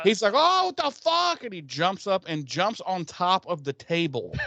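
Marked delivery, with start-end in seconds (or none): 0.3-1.4 high, sharp shocked yell; mock the panic with a pitched-up outburst; freaking out. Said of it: "he completely loses his shit".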